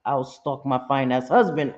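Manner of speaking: normal talking voice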